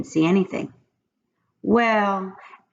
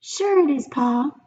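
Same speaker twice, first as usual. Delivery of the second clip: pigtail voice; 8 semitones higher than usual. A narrator's reading. The pigtail coaxes Paul in a high little voice. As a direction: coax him like a tiny tempter. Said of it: "said the pigtail".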